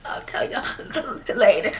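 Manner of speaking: mock sobbing, barely getting words out; hammed-up crying, too choked to talk; imitated bawling. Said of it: "like, I can't even talk".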